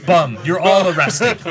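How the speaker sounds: loud, high comic proclamation; mock arrest order. Airport officer arresting everyone over a misheard word, mock shout. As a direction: call it out like an overzealous officer.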